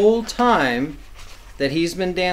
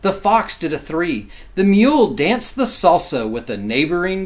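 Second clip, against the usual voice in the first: lively, sing-song picture-book reading; reading to children; 3 semitones higher, 4 dB louder than usual. A narrator's reading. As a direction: read it like bedtime rhyme for kids.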